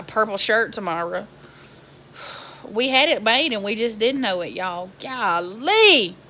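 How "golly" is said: usual speaking voice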